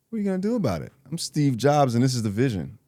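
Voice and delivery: ordinary talk, nothing marked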